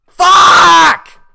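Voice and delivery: high, full-force scream; explosive high-pitched yell; real rage scream. Real, not acted. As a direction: scream the curse in frustration. Dies in the game.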